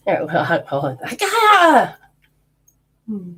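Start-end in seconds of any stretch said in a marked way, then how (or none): 1.2-2.0 high, loud sudden cry of surprise; startled cry. Real, not acted. Psychic jolted mid reading, a high startled cry. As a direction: yelp as if jolted.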